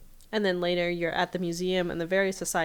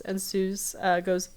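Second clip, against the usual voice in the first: normal talking voice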